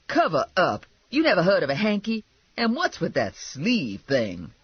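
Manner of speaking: yelling in indignation; Lula. Acted. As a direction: scold the offender, slowing at the end. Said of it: "Lula yelled".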